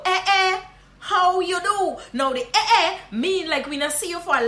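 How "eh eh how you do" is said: high, loud Guyanese Creole greeting with a laugh. Shown as a demonstration. Guyanese teacher greeting a long-lost friend, laughing. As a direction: call out Guyanese-style, surprised. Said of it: "that is how we just greet people".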